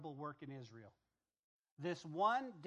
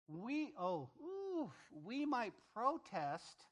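drawn-out, high-pitched token objection; half-hearted mock objection, slow and high; mock protest; 6 semitones higher, much slower than usual. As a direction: stretch it out slowly in a higher, put-on protest. Voicing nations' feeble protest.

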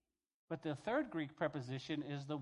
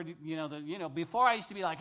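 ordinary talk

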